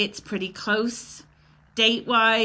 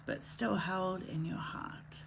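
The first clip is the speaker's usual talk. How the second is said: slow, breathless whisper on the final line; whispered poem ending; 4 semitones lower, 13 dB quieter than usual. Acted. Host finishes a grief poem for her viewers, slow whisper. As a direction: let the last line of the poem fade into a whisper.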